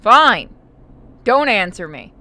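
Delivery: sharp, loud "Fine", then a sulky jab; annoyed. Real, not acted. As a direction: snap at a friend who ignores you. Friend muted, not answering.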